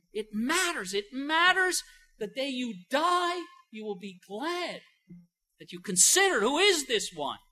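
usual speaking voice

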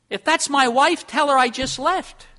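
loud, high voice of a man dodging his wife; bar patron. Retold or imitated. Bar patron asking the barman to lie to his wife, loud and high. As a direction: call it out like a guilty drinker.

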